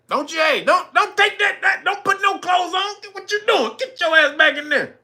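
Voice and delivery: high shouted orders to get back in the room; hammed-up yell calling her back; mock angry yell. Acted man yelling at woman.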